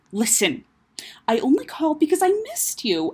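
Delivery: breathy, half-whispered plea; sincere confession. Acted. Sister admitting to brother she missed him, earnest. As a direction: let the voice go airy and earnest. Sister sighs, softens after quarrel.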